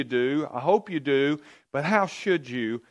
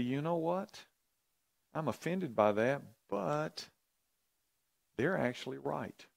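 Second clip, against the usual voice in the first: soft, hushed, talking to yourself; quiet inner admission; 7 dB quieter than usual. Retold or imitated. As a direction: murmur it as a private thought, much softer. Inner response admitting they're right.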